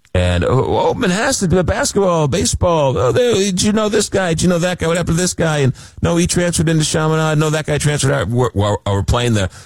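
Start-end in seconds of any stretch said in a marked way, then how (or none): 0.4-8.3 eager chatter. Retold or imitated. Retold Long Island small talk.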